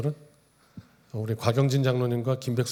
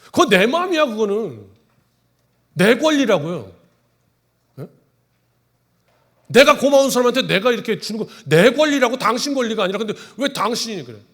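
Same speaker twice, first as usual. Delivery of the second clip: higher, louder indignant retort; indignant giver; 9 semitones higher, 7 dB louder than usual. Retold or imitated. Shopkeeper tells the jealous neighbor off, indignant and loud. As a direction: defend your right to give, loudly.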